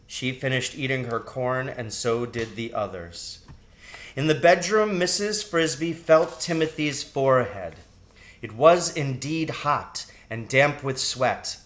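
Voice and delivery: plain reading voice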